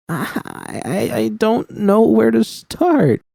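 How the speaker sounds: very high, slow, sob-broken; sobbing. A narrator's reading. Judy sobbing to V about her life, very high and slow. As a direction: force the words out between sobs. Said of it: "I managed between sobs".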